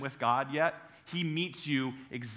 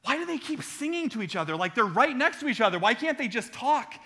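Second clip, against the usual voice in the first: confused; 8 semitones higher than usual. Retold or imitated. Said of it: "I go".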